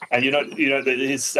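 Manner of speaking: usual speaking voice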